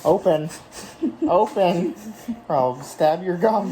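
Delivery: loud playful command. Real, not acted. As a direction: bark it, half laughing. Forcing mouth open during dye.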